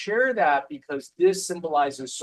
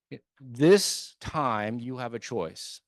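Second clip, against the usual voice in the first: usual speaking voice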